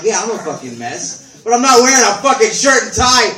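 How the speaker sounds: high, loud ranting burst; mock rant. Comic to the crowd about the sweatpants guy, ranting loud. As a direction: rant it like it's outrageous.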